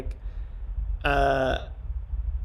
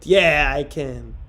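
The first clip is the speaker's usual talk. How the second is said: ordinary talk, nothing marked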